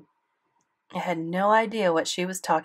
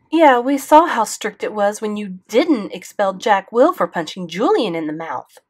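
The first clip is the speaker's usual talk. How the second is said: quick sarcastic reply; 4 semitones higher, 8 dB louder than usual. A narrator's reading. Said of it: "Mom answered quickly".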